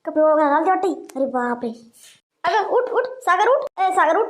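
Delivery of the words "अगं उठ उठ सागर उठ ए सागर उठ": urgent calling. Acted. Trying to wake fallen woman.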